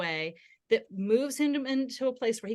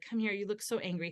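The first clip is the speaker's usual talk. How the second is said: soft, tender empathy; hushed comforting voice; soothing parent; 2 semitones lower, 6 dB quieter than usual. Retold or imitated. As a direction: gently acknowledge the child's anger. Retold comforting of angry son.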